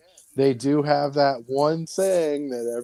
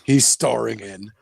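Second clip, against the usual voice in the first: slow, low trailer announcer; mock-epic voice-over, drawn out; movie trailer voice; 4 semitones lower than usual. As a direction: announce the title like a film promo. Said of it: "It's like the movie voice".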